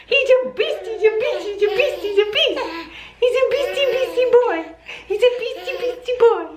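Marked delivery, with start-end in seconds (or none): none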